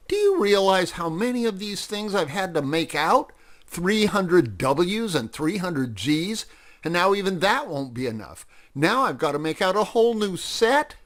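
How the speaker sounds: high-pitched, put-upon protest; exasperated complaint. A narrator's reading. Freddy protests the extra work.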